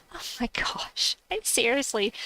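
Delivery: whispered disbelief. Real, not acted. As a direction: breathe it out, half laughing. Whispered after laughing.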